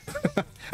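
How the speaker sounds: high squeal of a laugh; squeaky giggle. Real, not acted. Gamer to viewers after the ballet joke, high squeaky giggle. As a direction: crack up at his own joke.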